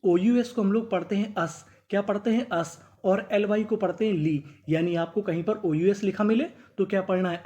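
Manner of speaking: ordinary talk, nothing marked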